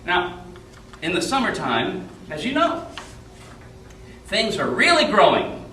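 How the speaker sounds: put-on lively storytelling for children; on stage. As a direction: tell it to a young audience, drawing them in.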